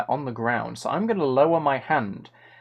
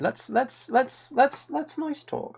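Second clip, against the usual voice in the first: high squeaky voice; 9 semitones higher, 4 dB quieter, slower than usual. Pretend. Silly high voice after zombie request.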